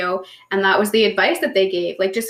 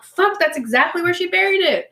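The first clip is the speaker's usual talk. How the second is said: amazed; 9 semitones higher than usual. Retold or imitated. Retold moment of realisation.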